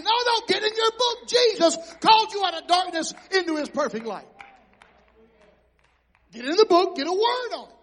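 preaching shout. Real, not acted. Preacher exhorting at full force.

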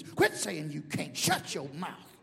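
low, drawn-out mock scolding; self-scolding. Preacher arguing with his own doubts, scolding himself. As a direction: tell yourself off slowly and firmly.

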